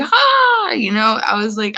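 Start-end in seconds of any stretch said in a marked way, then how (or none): 0.0-0.7 put-on very high, loud child's squeal of hi; little kid's hi. Little kids walking up to greet her, very high excited hi. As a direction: chirp it like an excited small kid. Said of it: "little kids ... going like".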